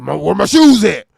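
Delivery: loud barking rapper voice. Retold or imitated. [name] impression demanding his shoes, loud bark. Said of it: "I said".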